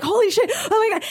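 high, rushed-out alarm; high-voiced frantic exclamation; retold panic.

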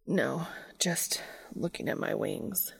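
slow, hushed whisper. A narrator's reading. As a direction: whisper it, overwhelmed. Cass overwhelmed, hushed reply.

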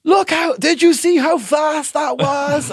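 high, loud, slow crowing shout; retold excited boast. Comic showing off a quick joke to the crowd, excited shout. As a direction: crow it out in amazement at yourself.

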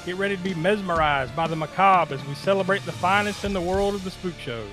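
dramatic scripted intro read; awards show host. Acted. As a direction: read it like a spooky emcee.

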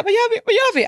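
high, loud panicked repeat to her friend. Retold or imitated. Retelling panic to Emilia on the trail, high and loud. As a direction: blurt it out in rising panic. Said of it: "Alltså, jag fick panik".

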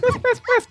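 high yappy ruff-ruff; little dog barks. Retold or imitated. Teller imitates the small dog running out, high yapping. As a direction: yap like a tiny dog.

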